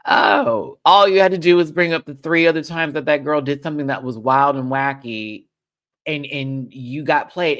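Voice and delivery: loud, high exasperated outburst; mock frustrated shout at the TV; exasperated yell. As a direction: yell at the screen in disbelief. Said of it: "And I'm like".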